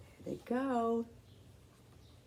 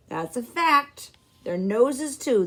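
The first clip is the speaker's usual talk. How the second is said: a hint of a jovial man, louder; lightly voiced friendly old man; 5 semitones higher, 8 dB louder than usual. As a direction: chuckle along with the grandmother. Blind man speaks.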